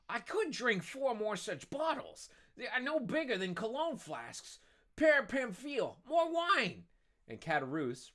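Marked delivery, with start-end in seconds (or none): 0.1-6.9 high-pitched boozy character voice; high, tipsy bluster rising to a call; boastful drunk. Acted. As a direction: brag drunkenly, then shout for more. Drunk Caderousse boasting, calling for wine.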